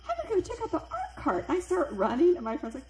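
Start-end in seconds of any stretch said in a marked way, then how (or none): 0.1-1.4 eager, high-pitched burst; excitedly announcing, pitch way up; retold excitement. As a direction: act out giddy eagerness to go and look. Eager to see art cart.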